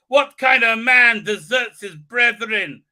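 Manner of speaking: loud, slow mock accusation; hammed-up indignant challenge; pissed off. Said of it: "she's all pissed off with him".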